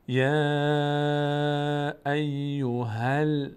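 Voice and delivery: یا ایوهل stretched very slowly, هل joined to the lam; slow joined reading. Shown as a demonstration. Teacher joining the fatha to the lam, very slowly.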